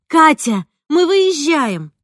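loud, high, slow shouted announcement; shouting into the phone. A narrator's reading. Mother telling her sister on the phone they are leaving, loud shout. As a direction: call out so the words carry far. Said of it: "закричала в трубку так громко".

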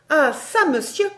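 high, loud challenge; angry accosting. Acted. Barbara addressing a tree as monsieur, indignant. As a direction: call him to account loudly.

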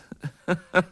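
a laugh that tips into madness; manic laugh. Acted. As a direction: burst into a crazed laugh. Fabian breaks into laughter.

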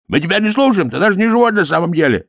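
high, hurried yell; shouting priest. A narrator's reading. Another priest shouts down Dorfl, indignantly yelling. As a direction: shout the golem down, high-pitched. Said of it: "закричал другой жрец".

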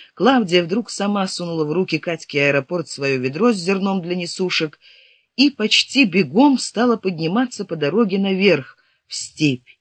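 ordinary delivery

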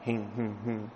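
हिं, हिं, हिं as short, soft nasal hums; softly voiced हिं, हिं, हिं like Sama chanting; hinkar syllables. Shown as a demonstration. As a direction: demonstrate the हिं, हिं, हिं chant sounds quietly. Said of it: "ऐसे बोलते हैं".

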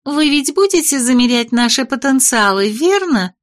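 a hint of a pushy young lady; high-voiced young lady. Voices the doll-like lady Malisa.

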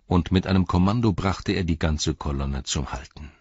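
ordinary delivery

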